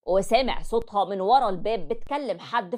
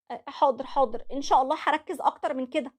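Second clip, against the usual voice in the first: wife's submissive reply; 6 semitones higher than usual. Acted. Wife promising her husband to do better, meekly.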